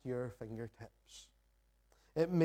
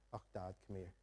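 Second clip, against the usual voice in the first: much softer, tender and fond; affectionate call; 6 semitones lower, 13 dB quieter, faster than usual. Retold or imitated. Daughter fondly calling her dad into the room, soft and tender. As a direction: say it as a loving child. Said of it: "a moment of intimacy and affection in that moment".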